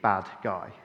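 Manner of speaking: normal talking voice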